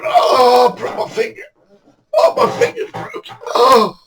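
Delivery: very high, thin crying moan; mock agonised wail. Victor in the boat with a broken finger, mock wailing. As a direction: wail pitifully, hammed up. Victor sitting there hurt.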